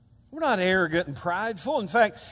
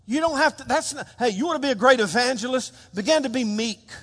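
normal talking voice

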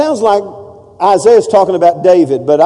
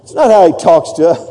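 ordinary delivery

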